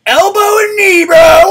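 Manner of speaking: pumped-up, very high full-volume yell. Pretend. Streamer daring online threateners to show up, pumped-up yelling. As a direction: yell it like a fighter charging in. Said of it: "sorry, I was yelling".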